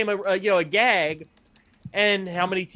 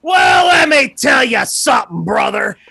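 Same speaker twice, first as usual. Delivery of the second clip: wrestling promo; 5 semitones higher, 9 dB louder than usual. Pretend. Joining the wrestling promo bit.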